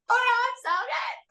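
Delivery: high, breaking voice insisting she's fine; teary high reassurance. Retold or imitated. Answering her worried kids through tears, high and teary. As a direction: brush it off while choking up. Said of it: "And I was like".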